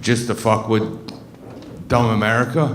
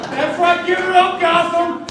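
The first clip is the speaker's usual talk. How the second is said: hyping the crowd; slower than usual. Real, not acted. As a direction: pump up the room like a host.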